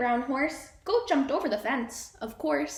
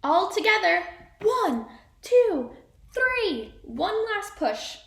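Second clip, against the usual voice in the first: louder, higher rallying count; rally everyone to push; excited count; 8 semitones higher, 6 dB louder, slower than usual. Acted. The animals pushing the trucks, a loud high count.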